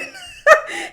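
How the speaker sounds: a sudden shrieking laugh, near tears; high squealing laugh. Real, not acted. Host cracking up at the roast, squealing laugh. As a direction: laugh so hard it breaks high.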